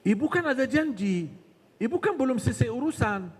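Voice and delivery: high, insistent tempter's reminders; bisikan syaitan. Acted. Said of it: "langsung dibisikin terus dengan kesibukan". (setan mengingatkan dengan nada tinggi)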